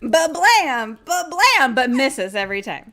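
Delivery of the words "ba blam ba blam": loud, higher ba-blam gun noises; gunshot sounds. Acted. Nathan blasting his shotgun at the leprechaun. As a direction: fire off the shots with your mouth. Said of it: "Nathan is like".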